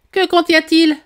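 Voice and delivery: high, loud royal query; demanding question. A narrator's reading. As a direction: ask it sharply up high. Queen questioning about the paper.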